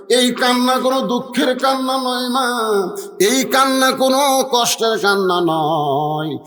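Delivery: high, melodic sermon delivery; impassioned preaching. Acted. Preacher voicing the Prophet consoling Fatema, impassioned preaching. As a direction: lift the words into a preaching chant.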